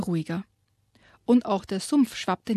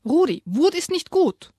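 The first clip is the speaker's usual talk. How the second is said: raised, stern father's warning; louder, higher stern dad voice; 8 semitones higher, 6 dB louder than usual. A narrator's reading. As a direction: warn him loudly and firmly. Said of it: "die Stimme lauter".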